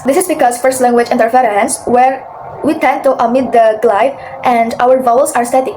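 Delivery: ordinary talk, nothing marked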